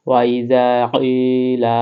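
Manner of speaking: "কিলা" with its vowel held one alif long, slow; drawn-out vowel. Shown as a demonstration. Teacher demonstrating elongation to viewers, slow stretched reading. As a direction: stretch each syllable, holding "কিলা" a beat. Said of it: "এক আলিফ টেনে পড়তে হয়".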